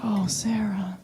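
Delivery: hushed, grave whisper of pity; solemn whisper. Retold or imitated. Friend turning off the TV to ask gravely, whispered. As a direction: breathe it out like bad news. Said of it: "She turned to me very solemnly".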